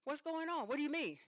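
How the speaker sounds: high, urgent alarmed questions; high-pitched, startled and worried. Retold or imitated. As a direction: fire off the questions in a high panic. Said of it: "So now I'm alarmed".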